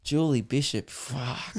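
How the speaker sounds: hushed mock-lustful curse; lustful whisper. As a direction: mutter it under your breath, ogling. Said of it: "I've never been like".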